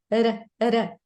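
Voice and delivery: quick tongue taps in place of "R, R"; tap or flap sound. Shown as a demonstration. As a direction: flick the tongue for each short "R". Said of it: "you can make the tap or flap sound".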